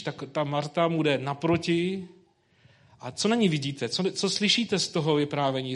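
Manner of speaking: usual speaking voice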